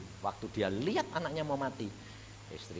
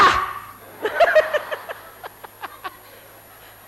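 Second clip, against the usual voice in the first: crowd laughter; 16 dB louder than usual. Real, not acted. Audience laughing.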